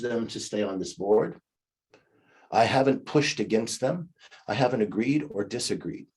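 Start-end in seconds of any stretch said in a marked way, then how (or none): none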